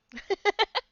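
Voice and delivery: high loud laugh; burst of laughter. Real, not acted. GM cracking up at the backstory montage, loud laugh.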